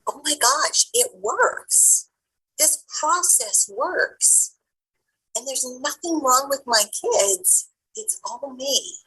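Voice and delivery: high, slow, awestruck discovery; mother realizing her parenting technique actually works; amazed realization. Retold or imitated. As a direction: marvel as it finally sinks in. Self-discovery quoted in story.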